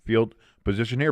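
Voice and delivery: ordinary talk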